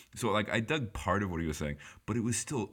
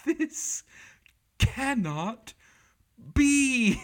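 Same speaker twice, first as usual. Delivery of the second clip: put-on British accent, high, each word hammered out; incensed British voice; 7 dB louder, much slower than usual. British moderator incensed at the noise, high and loud. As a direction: spell out each word in outrage. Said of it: "this guy was incensed".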